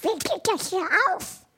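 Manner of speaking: very high cartoon duck squawk; Donald Duck voice. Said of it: "Donald Duck Stimme".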